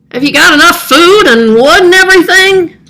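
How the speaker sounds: put-on a boy greeting the old man brightly; young neighbor boy. Axel asking Festus.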